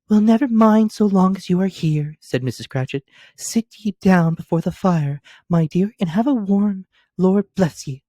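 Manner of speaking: put-on a mother settling her girl by the fire; Mrs Cratchit welcoming. A narrator's reading.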